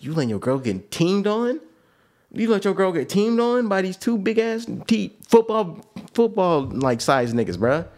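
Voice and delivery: incredulous scolding. Retold or imitated. Scolding girl about her friend.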